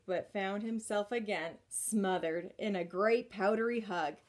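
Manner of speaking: plain reading voice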